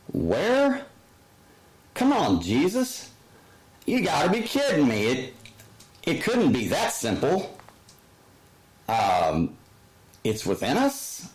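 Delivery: high, incredulous protest, rushed in the middle; mock disbelief. Listener scoffing at Jesus, high mock disbelief. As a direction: scoff at a teaching that sounds too easy.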